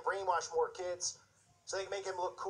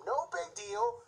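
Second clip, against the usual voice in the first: sarcastic; 11 semitones higher, much slower than usual. Real, not acted. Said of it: "sarcastic, drawn out high".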